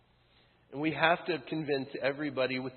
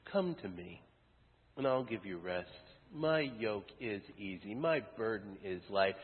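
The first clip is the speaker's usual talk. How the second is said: tender invitation; 5 semitones lower, 6 dB quieter than usual. Retold or imitated. Jesus gently inviting the weary, softer and lower. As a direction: offer rest like a quiet promise.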